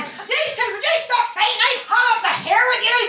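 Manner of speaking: high-pitched, rushing Northern Irish telling-off; hammed-up Northern Irish teacher at the end of her tether; Missus Dixon. Teacher breaking up pupils' fight, high Northern Irish scolding. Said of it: "she was from Northern Ireland".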